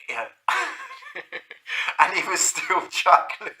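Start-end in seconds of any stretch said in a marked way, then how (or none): 0.3-1.9 a high, breathless wheeze of laughter; high wheezy laugh. Real, not acted. Narrator wheezing with laughter at the memory.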